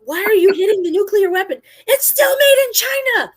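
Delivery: high, loud exasperated movie yell; guy in Armageddon. Retold or imitated. Movie character hitting a nuclear weapon, high frantic shouting. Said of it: "the guy in Armageddon when he's hitting things".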